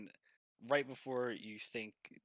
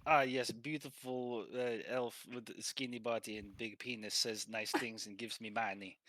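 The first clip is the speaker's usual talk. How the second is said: put-on appreciative Russian accent; Russian masseur. Masseur thanks the elf for money, Russian accent. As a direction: praise grandly in a Russian accent. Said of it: "Oh, he goes".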